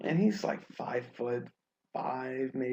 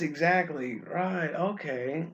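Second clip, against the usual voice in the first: ordinary talk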